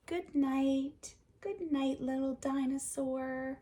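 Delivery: gentle bedtime farewell; soft goodnight. Acted. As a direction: lull them to sleep. Reading last page softly.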